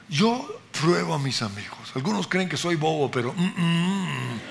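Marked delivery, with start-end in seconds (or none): none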